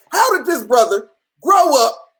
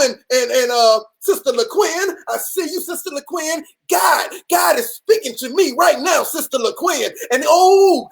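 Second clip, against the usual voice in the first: mock prophet; 2 semitones higher than usual. Prophet on a live calls out Sister LaQuinn, hammed-up preacher voice.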